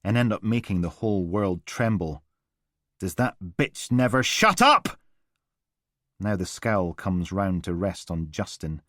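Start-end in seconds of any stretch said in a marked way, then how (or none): 3.0-5.0 squeezed angry growl. A narrator's reading. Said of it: "words start out squeezed between his teeth".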